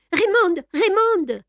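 high, loud desperate calling of her name; screaming for his dying love; anguished cry. A narrator's reading. Lupin cries out to fallen Raymonde.

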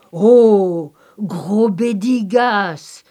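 a loud, drawn-out cry of dismay; the cousin bursts out at the mess. Acted. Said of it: "s'écria le cousin".

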